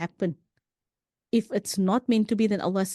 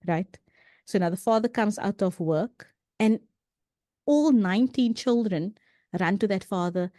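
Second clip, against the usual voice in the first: ordinary talk, nothing marked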